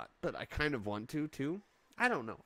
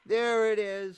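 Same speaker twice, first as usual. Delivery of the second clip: louder, satisfied; loud triumphant line; triumphant there it is; 10 semitones higher, 10 dB louder than usual. Real, not acted. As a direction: gloat that the cat meowed. Cat meows, triumph.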